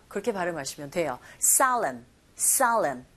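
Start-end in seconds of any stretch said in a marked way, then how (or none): 1.4-3.1 "Solemn" with a stressed ah, "SAH-lem"; O sounded as ah. Shown as a demonstration. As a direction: say the o in "Solemn" close to ah. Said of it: "오보다는 아에 가깝게 발음이 들리고". ("Solemn"의 o를 '아'에 가깝게, 강세를 주어)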